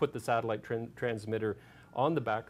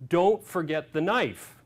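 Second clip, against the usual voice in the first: louder, higher, slowed order; mock exasperated yell; frustrated reminder; 6 semitones higher, 9 dB louder, slower than usual. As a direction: call it out firmly, drawn out. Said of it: "an explosive personality, was getting very frustrated".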